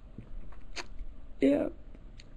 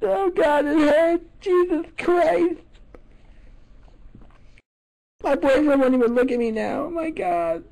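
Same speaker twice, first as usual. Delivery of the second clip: hammed-up pain; 10 semitones higher than usual. Caller fakes pain.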